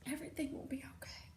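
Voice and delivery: fast, soft whispered comfort; soothing whisper. Acted. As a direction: hush him like a scared child. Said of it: "like he was a scared little kid".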